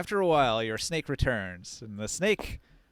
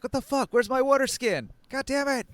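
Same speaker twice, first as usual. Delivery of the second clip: high, loud outraged yell; angry camper; 13 semitones higher, 6 dB louder than usual. Retold or imitated. Camper yelling that the water is gone. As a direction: shriek about the stolen water skin. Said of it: "some people will be like".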